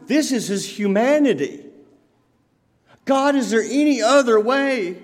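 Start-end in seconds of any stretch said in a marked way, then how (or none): 3.0-5.0 higher, louder, pleading with God; anguished plea. Acted. Jesus pleading with God in the garden, anguished. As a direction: beg God for another way.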